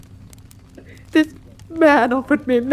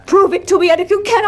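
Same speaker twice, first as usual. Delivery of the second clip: louder and higher, pressing hard; rising demand; 7 semitones higher, 3 dB louder, much faster than usual. Acted. Wife confronting Holmes, louder insistent demand. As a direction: flare up and insist.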